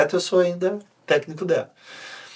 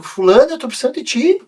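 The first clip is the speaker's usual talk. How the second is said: urgent plea; 10 semitones higher, 4 dB louder than usual. Retold or imitated.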